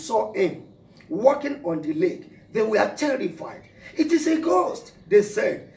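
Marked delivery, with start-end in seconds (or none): 4.0-4.9 cried out in fear. Acted. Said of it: "cried out in fear".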